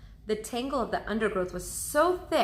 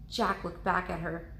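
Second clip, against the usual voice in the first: plain reading voice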